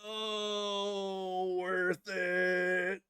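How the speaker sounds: hammed-up sob through the words; mock crying. Soaked by his brother's bedwetting, mock crying resignation. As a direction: fake weeping, grinning through it. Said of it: "And I'm like".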